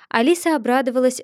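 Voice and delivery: ordinary delivery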